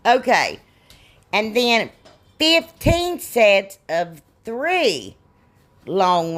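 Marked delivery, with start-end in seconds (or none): none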